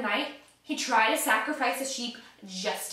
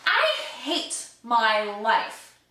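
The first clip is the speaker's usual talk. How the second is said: normal talking voice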